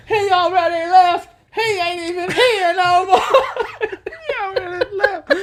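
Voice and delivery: very high, loud mock scream, slow, laughing through it; exasperated yelling woman. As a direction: shriek it like a fed-up woman calling across a lot. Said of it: "that woman's voice will be forever stuck".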